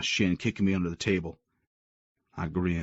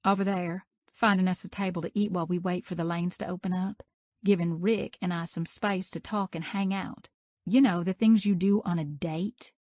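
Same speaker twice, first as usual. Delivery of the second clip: Mia, pointed; 11 semitones higher than usual. A narrator's reading. Mia telling Creed to leave her date alone, high and pointed. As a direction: shoo him away with pointed emphasis.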